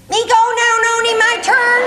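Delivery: high, loud toddler impression; eager little boy. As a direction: pipe up like a small child demanding a turn. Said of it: "Joseph's at the top of the stairs".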